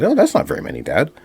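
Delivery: a hint of a casual kid; son's voice. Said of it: "that's what he said".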